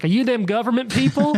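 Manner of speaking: mock a dim bucktoothed local; bucktooth yokel. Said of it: "he's, like, bucktooth and like".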